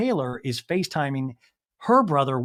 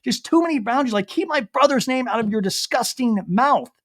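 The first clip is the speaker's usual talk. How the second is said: higher, indignant retelling of someone upset; mock distraught, pitched up; imitated tearful outrage; 7 semitones higher than usual. Recapping Olivia crying to Venita, imitated outrage. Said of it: "Olivia is hyperventilating, crying".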